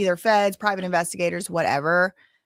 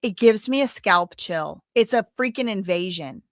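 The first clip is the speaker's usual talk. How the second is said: ordinary delivery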